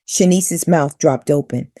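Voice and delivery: usual speaking voice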